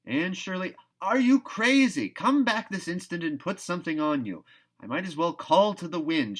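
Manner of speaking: high, loud exasperated calling; calling after her. A narrator's reading. Marilla calls after Anne racing off bareheaded, loud and exasperated. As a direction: shout after a girl who's already gone.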